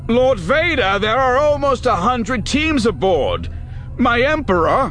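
high, loud pleading call; desperate shout. A narrator's reading. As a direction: shout after him in alarm. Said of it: "Lewitt called after him".

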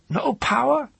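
very high, loud questioning crone; high-pitched old woman's challenge; distressed old woman. A narrator's reading. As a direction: echo it as a high, indignant question.

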